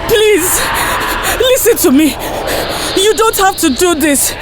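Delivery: high, tearful pleading; crying, begging to be heard; teary voice. Acted. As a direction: plead through sobs. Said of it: "said in a teary voice".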